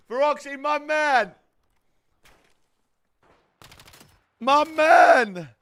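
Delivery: 1 excited greeting. Real, not acted.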